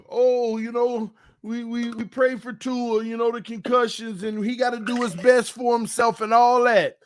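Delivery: imitating fake concern; mock sympathy. Mocking fans' fake sympathy.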